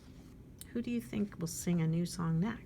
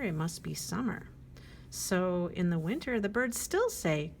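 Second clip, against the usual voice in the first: ordinary delivery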